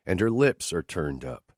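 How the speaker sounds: normal talking voice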